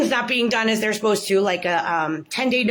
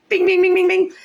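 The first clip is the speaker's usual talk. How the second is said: alarm bell sound; 10 semitones higher, 6 dB louder than usual. Retold or imitated. Spidey senses going off.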